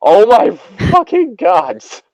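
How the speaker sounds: high shrieking fright; frightened scream. Real, not acted. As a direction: scream in sudden real fright. Startled by sudden noise.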